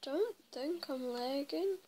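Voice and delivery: usual speaking voice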